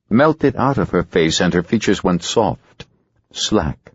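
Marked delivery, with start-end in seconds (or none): none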